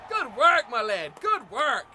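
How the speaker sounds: loud, punchy congratulations; cheering praise. Real, not acted. Gamer congratulating the player after the play, excited. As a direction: shout well done to a teammate.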